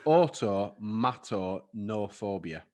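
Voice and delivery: automatonophobia said slowly, syllable by syllable; slow drawn out. Shown as a demonstration. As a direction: spell out automatonophobia slowly and carefully. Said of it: "I'll say it again".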